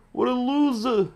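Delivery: a taunting falsetto loser; high mocking voice. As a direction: jeer it in a squeaky mock voice. Mocking a player in game.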